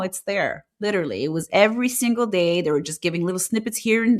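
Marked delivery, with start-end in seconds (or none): none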